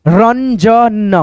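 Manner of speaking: Ranjan very slowly split as रन, ज, न; drawn-out teaching version of Ranjan; syllable-by-syllable reading. Shown as a demonstration. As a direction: stretch every sound of Ranjan. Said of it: "इस बिंदी को हम लोग न पढ़ते हैं".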